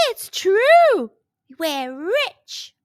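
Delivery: high, loud joyful shout; excited. A narrator's reading. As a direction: burst out with joy. Hen lays gold egg.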